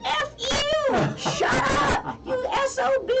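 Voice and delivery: high, screeched parrot imitation; cussing parrot. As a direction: screech the insults like an angry bird. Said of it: "he hears the bird outside there going".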